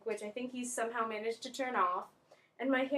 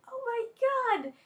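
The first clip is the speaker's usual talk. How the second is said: high, louder, drawn-out shocked cry; acted alarm; 12 semitones higher, 5 dB louder, slower than usual. Student retells soaking the class, acted alarm. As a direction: exclaim in mock horror, slowly.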